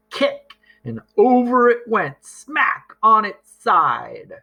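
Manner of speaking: high, building, then slowing onto the fall; dramatic tumble. A narrator's reading. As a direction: narrate the crash with relish. Box topples dramatically.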